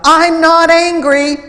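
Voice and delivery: loud, high, indignant protest; angry denial. Retold or imitated. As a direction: bark the denial back at him. Angry employee denies his anger.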